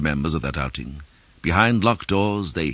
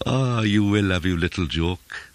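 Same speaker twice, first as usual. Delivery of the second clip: sly smiling voice; 4 semitones higher than usual. A narrator's reading. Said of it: "smiling like a razor".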